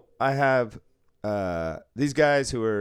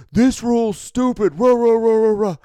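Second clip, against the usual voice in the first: much higher, loud mock protest chant; mock ranting activist; 11 semitones higher, 10 dB louder than usual. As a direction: ham up an angry protester.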